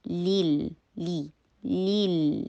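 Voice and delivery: لیل with a long "ee"; slow syllable demo, clean "ee" in لیل; clear ee sound. Shown as a demonstration. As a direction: draw out "leel" on لیل. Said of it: "ای کی sound آئے".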